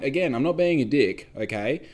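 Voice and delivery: normal talking voice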